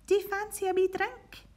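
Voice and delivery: kind mum offering. Acted. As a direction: offer the tiger a drink sweetly. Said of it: "Sophie's mum said".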